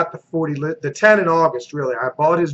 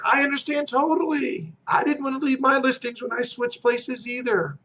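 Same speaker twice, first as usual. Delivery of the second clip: softer, understanding agent; reassuring; 6 semitones higher, 6 dB quieter than usual. Shown as a demonstration. Agent to a seller afraid to switch, soft and reassuring. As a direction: ease a nervous seller's worry.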